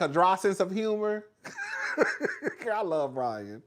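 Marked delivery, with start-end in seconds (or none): 1.4-2.7 very high, softened laugh; high giggle. Real, not acted. Streamer gushing over a TV realtor's humor, high giggle.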